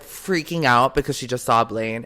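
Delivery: usual speaking voice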